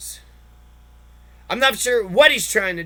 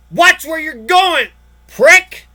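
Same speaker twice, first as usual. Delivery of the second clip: slow, loud, higher snarl at a stranger; angry stranger; 6 semitones higher, 8 dB louder, much slower than usual. Retold or imitated. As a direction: bark it like the rude guy who bumped you. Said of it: "they get mad at you".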